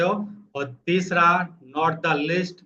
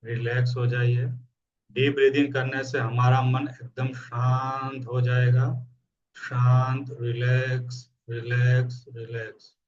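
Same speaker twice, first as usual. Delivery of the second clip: calm, lower counting with long pauses for breath; guided meditation; 4 semitones lower than usual. Acted. Coach counts breaths for listeners, calm meditation voice. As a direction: soothe them, drawing out the relaxing words.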